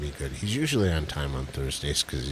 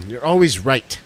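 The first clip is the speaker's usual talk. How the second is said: sarcastic; 7 semitones higher, 7 dB louder than usual. Acted. Teasing concession.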